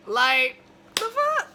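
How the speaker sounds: incredulous. Real, not acted. Incredulous reaction.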